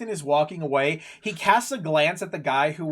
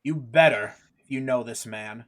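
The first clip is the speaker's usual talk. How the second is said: low, deliberate warning from an officer; captain keeping troublemakers in check; stern guard captain; 5 semitones lower than usual. Acted. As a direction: lay down the law, low and measured.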